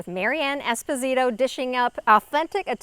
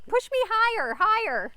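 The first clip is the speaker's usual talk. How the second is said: put-on high, playful kid pleading; child begging; 11 semitones higher than usual. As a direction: beg like a kid on a swing. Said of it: "What parent hasn't heard that?".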